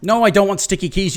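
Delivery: swatting away the popup; annoyed yell. Real, not acted. Gamer yelling at the sticky keys popup, annoyed yell.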